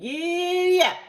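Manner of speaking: high, loud, stretched holler; long high giddy-up yell; cowboy shout. A narrator's reading. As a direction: urge the horse on with a whoop. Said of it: "shouted Cowboy McCoy".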